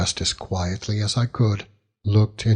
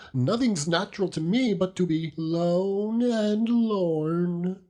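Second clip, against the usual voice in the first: put-on whining old widow; 10 semitones higher than usual. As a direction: moan it like the loneliest woman alive. Mrs Gummidge complains to Daniel.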